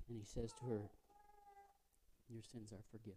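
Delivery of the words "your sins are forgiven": soft and low, full of compassion; gently forgive her, tenderly; compassionate. Acted. Jesus gently forgiving the woman, soft and low. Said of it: "all of a sudden he has compassion".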